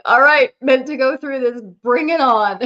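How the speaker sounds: loud, fired-up challenge; rally yourself loudly; determined. Retold or imitated. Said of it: "I'm like".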